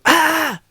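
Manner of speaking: short, high, loud imitated yell; sudden yell. Dad scaring ducks into flight, sudden loud yell. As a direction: holler out sharply to startle. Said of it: "He yells, goes".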